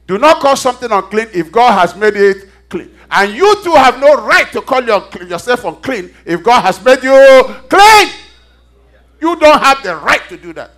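high, loud sermon at full force; fiery preaching. Real, not acted. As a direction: drive it home to the congregation, loud and emphatic.

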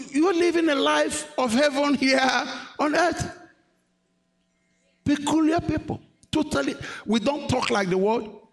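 high-pitched, stretched-out preaching; fervent preaching. Real, not acted. Preacher telling congregation they are a peculiar people, fervent preaching. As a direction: lift it high and draw out key words.

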